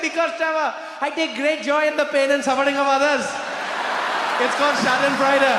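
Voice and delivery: put-on very high, loud, gloating Indian accent. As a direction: crow over a prank in an Indian accent. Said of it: "you start talking with accent".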